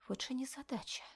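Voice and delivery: whispered to herself; whispering. A narrator's reading. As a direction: breathe it out as a whisper. Inner thought, whispered.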